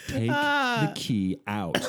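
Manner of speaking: high, loud, slow and drawn-out command; furious order. Retold or imitated. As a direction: spell each word out to a child in a rage. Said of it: "I snapped".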